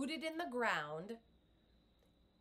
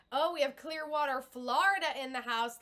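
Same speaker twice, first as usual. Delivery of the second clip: excited shout-out; 4 semitones higher, 7 dB louder than usual. Real, not acted. Greeting a viewer joining live.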